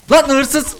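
high, sudden accusing cry; alarmed call-out. Real, not acted. Player spotting a thief at the stall, alarmed call-out.

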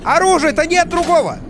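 fast, rising to a high shout; frantic, out of guns; panicked outburst. Real, not acted. Runs out of ammo.

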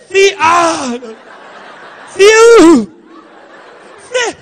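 high, loud moaning yeses breaking into laughter; laughing fake moans; mock moaning. Mock moaning yes during sex.

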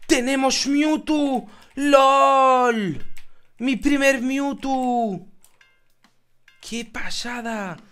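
high, very slow, drawn-out wonder; awestruck. Real, not acted. Gamer admiring his new Mewtwo, slow and awestruck. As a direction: linger on each word in awe.

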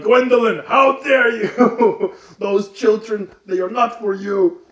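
high, loud, slow mock outrage with a laugh; hammed-up scolding of the vampire girl; the count scolds Gwendolyn in a loud hammy voice; outraged vampire count. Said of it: "he's like".

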